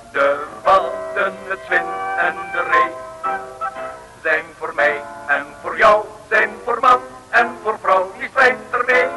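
ordinary delivery

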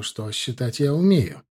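ordinary delivery, nothing marked

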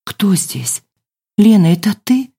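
lightly voiced drowsy woman; a hint of someone just woken; sleepy woman. A narrator's reading. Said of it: "заспанный женский голос проговорил".

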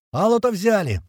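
high-pitched triumphant call; joyful yell. A narrator's reading. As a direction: announce the capture with glee. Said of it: "радостно орёт кто-то из ребят".

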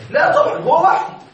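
emphatic scoffing. Shown as a demonstration. Teacher mocks the idea.

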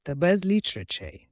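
plain reading voice

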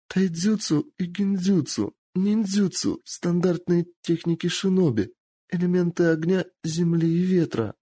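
lightly voiced captive girl. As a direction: answer meekly, afraid.

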